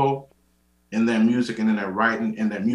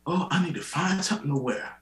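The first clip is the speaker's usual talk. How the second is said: higher-pitched mock fretting; lightly voiced grandmother, pitched up; imitating grandmother; 7 semitones higher, 3 dB quieter than usual. Grandmother fretting to grandchild about church clothes, lightly imitated.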